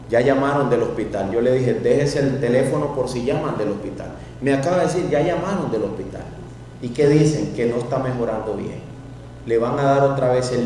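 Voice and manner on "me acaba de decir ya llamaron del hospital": ordinary talk, nothing marked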